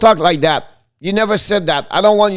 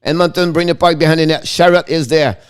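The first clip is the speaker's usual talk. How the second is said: play-by-play. Acted. Live play-by-play call.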